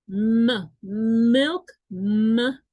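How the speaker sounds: m punched loud, then milk with a strong m at the start; stressed consonant demo. Shown as a demonstration. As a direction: hammer the m of milk. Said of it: "suena con sonido de consonante". (la m de milk marcada fuerte, luego milk con m clara)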